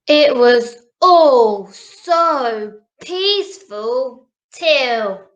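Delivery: gentle storybook narration; reading to children. A narrator's reading. Reads picture book to children.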